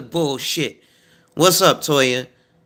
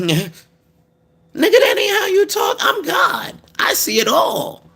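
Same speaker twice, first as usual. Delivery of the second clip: much higher, squeaky indignant God; mock God voice. God scolding someone who hides his cursing, squeaky mock indignation. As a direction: play God as a shrill scolding deity calling out a cursing believer. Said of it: "God gonna look at you and be like".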